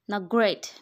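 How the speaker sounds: loud sarcastic shout; sarcastic yell. A narrator's reading. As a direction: snap the word great at her. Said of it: "Mister Dixon yelled again".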